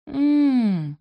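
high, helpless closed-mouth hum; a weak, helpless mmm; helpless moan. Acted. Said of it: "беспомощно промычала она".

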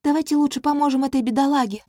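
high, quick princess voice; breezy young princess; cartoon princess. A narrator's reading. Princess changes subject.

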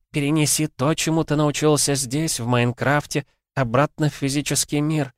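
a lightly voiced ancient oracle, high and solemn. A narrator's reading. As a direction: speak like a mild prophetic oracle. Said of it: "древний голос Оракула".

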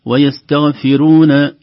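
"ويستغفرون" with a thin ra; wrong pronunciation. Shown as a demonstration. Teacher demonstrates the thin-ra mistake, chanted. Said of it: "تخرج الراء رقيقة".

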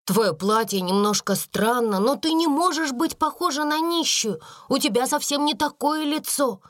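put-on girlish, emphatic reassurance; exclaimed. A narrator's reading. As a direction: insist warmly against the idea. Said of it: "воскликнула Эрмингарда".

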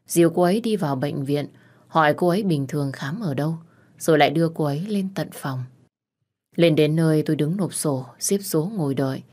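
usual speaking voice

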